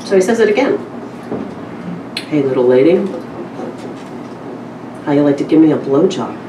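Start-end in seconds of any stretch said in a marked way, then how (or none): none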